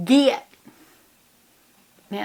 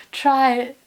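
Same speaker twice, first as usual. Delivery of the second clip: mock sarcastic quote; 3 semitones higher, 4 dB louder than usual. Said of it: "they said".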